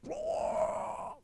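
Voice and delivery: very high drawn-out whoosh; long mimicked rushing noise; imitated yank whoosh. Shown as a demonstration. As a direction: voice the line being dragged away. Imitates line being yanked.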